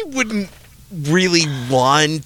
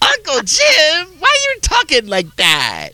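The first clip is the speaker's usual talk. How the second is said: put-on child voice. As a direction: voice a puzzled young nephew. Nephew voiced in story.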